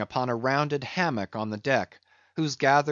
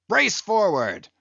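loud, urgent shouted orders; cried like lightning; 6 semitones higher, 5 dB louder than usual. A narrator's reading. Ahab orders his men away, crying out urgently. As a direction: fire off commands to the crew. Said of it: "cried Ahab like lightning to his men".

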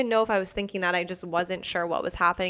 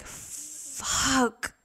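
soft, higher retold gasp of awe; floored amazement; 5 semitones higher, 5 dB quieter than usual. Customer told she has good energy, softly floored. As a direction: replay being blown away, under your breath.

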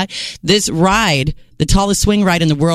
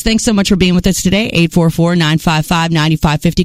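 ordinary talk

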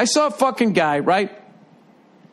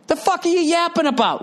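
high, mocking jab at a beaten player; mock trash talk; 9 semitones higher than usual. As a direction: sneer it like telling off a loudmouth. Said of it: "It's like".

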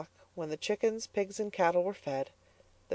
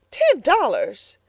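slow, louder aghast echo; cried out; 9 semitones higher, 6 dB louder, slower than usual. Acted. Mrs Comstock aghast at the ten dollars, slow and louder. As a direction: repeat it in disbelief. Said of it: "cried Missus Comstock".

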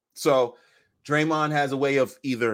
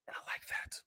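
whispered aside; 20 dB quieter than usual. Acted. Gossiping about rival teams.

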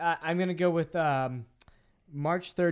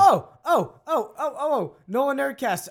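loud, high burst of eager oh's; excited blurting; 9 semitones higher, 9 dB louder than usual. Real, not acted. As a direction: blurt it out, bursting to answer first. Bursting to answer quiz question.

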